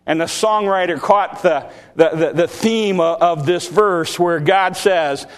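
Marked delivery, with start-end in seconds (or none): none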